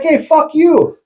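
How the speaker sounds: high-pitched mock holler; imitated yelling. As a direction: mimic a stranger hollering from afar. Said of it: "I thought a dude was yelling at me".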